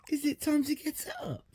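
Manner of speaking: breathy whispered question pitched very high; a playful secret whisper to the dog. Real, not acted. Asking her dog if it's time to get up, high playful whisper.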